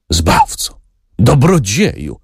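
slow, overcome with gratitude; moved voice. A narrator's reading. Grateful old nobleman to his benefactor, deeply moved. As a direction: let the thanks catch in your throat. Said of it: "mówił wzruszonym głosem".

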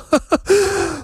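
high demonic madwoman's cackle; insane laugh. Acted. Deranged mother bursting into demonic laughter. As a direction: laugh like a woman gone mad. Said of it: "szatański śmiech, śmiech obłąkanej".

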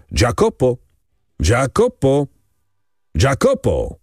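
restrained, spaced-out calls; calling out. A narrator's reading. Man on the quay summons Jacopo, calling out. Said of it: "i zawołał".

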